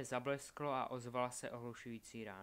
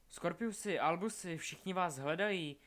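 high, raised, energetic call; excited calling out; 5 semitones higher, 6 dB louder than usual. A narrator's reading. Craig runs up to the searched-for boys, calling excitedly. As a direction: call out to them full of energy.

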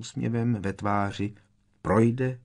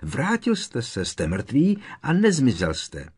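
high, forceful protest; upset insistence; agitated; 6 semitones higher, 5 dB louder than usual. A narrator's reading. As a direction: argue heatedly, voice raised. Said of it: "vyhrkl rozčileně Harry".

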